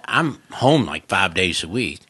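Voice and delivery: normal talking voice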